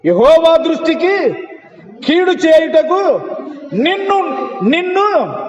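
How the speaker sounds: high, slow, weighty pulpit reading; preaching the verse slowly at full force; slow proclamation. Real, not acted. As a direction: spell out each phrase, pointing at them. Preacher declaiming scripture.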